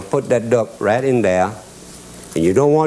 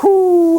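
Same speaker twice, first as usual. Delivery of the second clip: long, high, loud "whoo"; storyteller's astonished high whoop; amazed whoop; 5 dB louder than usual. Acted. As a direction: let out a big drawn-out whoop. Amazed at lake full of ducks.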